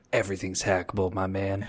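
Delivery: low, monotone Southern-tinged hacker; deadpan it down low like a monotone hacker; monotonous hacker character. Acted. Cohost voicing the forum poster's real attitude, low monotone. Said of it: "it sounded like you were trying to be monotonous".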